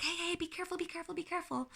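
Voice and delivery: high, worried "be careful" repeated; anxious parent warning. Shown as a demonstration. Parent anxiously calling to a climbing child, worried. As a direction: fret like a nervous parent at the playground. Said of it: "instead of saying".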